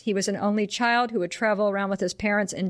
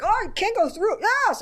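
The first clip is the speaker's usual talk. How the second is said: high, overdramatic gushing; big theatrical proud general voice; over-the-top general; 11 semitones higher than usual. Retold or imitated. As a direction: ham it up like a pompous general. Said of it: "he's just very over the top".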